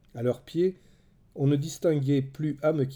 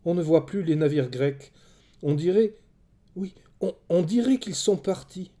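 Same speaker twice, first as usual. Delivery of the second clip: raised pitch, halting with dawning hope; astonished exclamation; 6 semitones higher, 4 dB louder than usual. A narrator's reading. Woman seeing the Greek ships have gone, astonished. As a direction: exclaim it, hesitating as the realisation lands.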